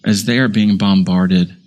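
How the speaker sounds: closing sermon prayer, humble and sincere. Acted. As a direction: pray over the congregation.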